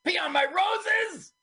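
high, loud outraged squeal; outraged gardener. Pretend. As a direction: shriek it like an offended gardener. Voicing the angry gardener.

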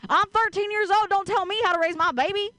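put-on sass it out in a country accent. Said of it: "in a country accent".

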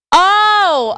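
high, loud startled oh; a jolt of mock shock; surprised oh. Real, not acted. Comic reacting to the fart sound, startled oh.

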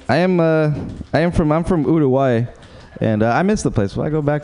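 normal talking voice

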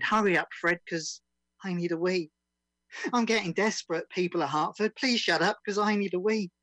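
lively, rhythmic comic verse read; comic performance poem. Acted. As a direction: deliver it as a bouncy comic ditty.

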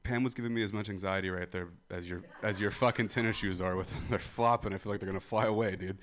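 ordinary talk

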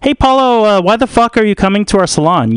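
lightly voiced stylist challenging a client; Cree Longstreet. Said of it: "So Cree Longstreet's like".

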